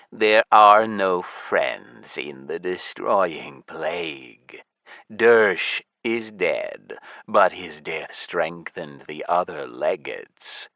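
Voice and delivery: lich voice. Acted. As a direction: speak like a cold ancient lich. Lich speaks slowly.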